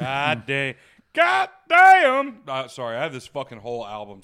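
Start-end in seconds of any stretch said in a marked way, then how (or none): 0.0-0.8 louder, drawn-out disbelief; taken-aback curse. Real, not acted. Host reacts to the month-long gap, in loud disbelief. Reacting to how late they are. 1.1-2.4 very high, very loud mock holler; hammed-up yell. Host overreacts to the month-long gap, in a high mock holler. Exaggerated repeat of the curse.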